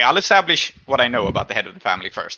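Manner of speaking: usual speaking voice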